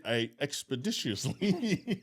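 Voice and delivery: slow, drawn-out word through laughter; amused. Real, not acted. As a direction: stretch it out while laughing. Joking about his hasty exit.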